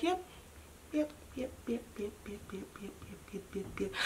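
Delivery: tiny whispered repetitions; whispered resignation. Retold or imitated. To self on being caught by police, whispering in resignation. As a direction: fade to a barely audible whisper.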